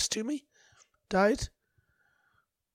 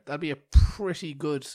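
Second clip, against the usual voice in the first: ordinary talk, nothing marked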